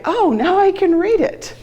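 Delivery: high-pitched delighted "oh, now I get it"; learner's aha moment, voiced high; sudden realization. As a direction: act out a lightbulb moment, pitch leaping up.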